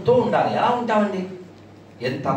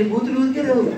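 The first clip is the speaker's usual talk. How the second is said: ad-slogan parody; 5 semitones higher than usual. Pretend.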